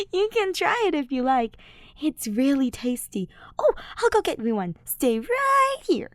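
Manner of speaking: put-on high, giggly drunk friend; tipsy Ash. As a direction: offer sweetly, drunk.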